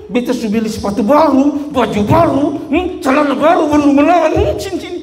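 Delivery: high mock parental nagging; scolding parent. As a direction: imitate a parent listing grievances. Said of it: "kita orang tua sering bilang begitu".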